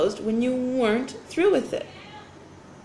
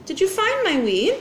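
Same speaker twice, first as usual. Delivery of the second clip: Oliver's voice; 7 semitones higher than usual. A narrator's reading. Oliver asks in read-aloud story.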